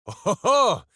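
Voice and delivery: loud, high jolly ho-ho-ho; booming gleeful laugh. Acted. Hero laughing at the ice before he burns it, jolly and loud. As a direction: laugh with heroic delight.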